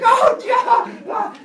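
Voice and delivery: high, loud laughing shriek; startled shriek. Acted. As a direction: shriek in fright. Pandora startled, laughing shout.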